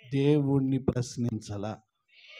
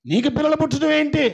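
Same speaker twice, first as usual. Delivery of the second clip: much higher, loud scornful jeering; loud mockery; 10 semitones higher, 7 dB louder than usual. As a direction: taunt the barren wife with contempt. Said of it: "శారాను పట్టుకొని".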